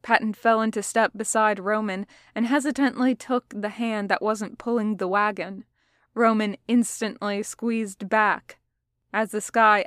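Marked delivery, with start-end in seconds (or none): none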